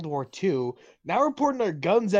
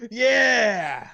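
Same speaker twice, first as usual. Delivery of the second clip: loud, high patriotic yell; hammed-up cheer; 7 semitones higher, 7 dB louder than usual. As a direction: cheer like a rowdy fan.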